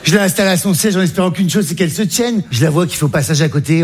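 normal talking voice

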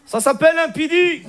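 put-on high, thick Moroccan accent in French; caught-out cousin, hammed-up Moroccan accent; Hicham. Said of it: "l'accent arabe".

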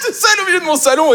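high, giggly cackle through the words; laughing alone. Acted. Writer alone at home amused by his own joke, high giggly laughing. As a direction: crack up in a high squeal at your own joke.

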